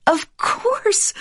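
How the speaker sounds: high, slow exclamation of discovery; sudden realisation. Acted. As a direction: cry out as it clicks. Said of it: "she cried".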